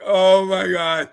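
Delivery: slow, loud stunned exclamation; stretched-out loud gasp of disbelief; stunned oh my God. Retold or imitated. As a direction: let it sink in, loud and drawn out. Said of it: "I'm like".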